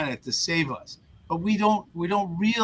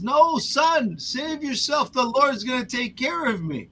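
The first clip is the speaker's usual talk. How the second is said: stubborn priest; 10 semitones higher than usual. Retold or imitated. Priest refuses again.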